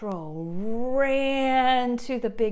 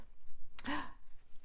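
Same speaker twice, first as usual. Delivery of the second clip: sharp unvoiced gasp; 7 semitones higher, 10 dB quieter than usual. Storyteller gasps before the troll appears. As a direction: suck in breath in alarm.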